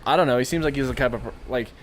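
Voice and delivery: usual speaking voice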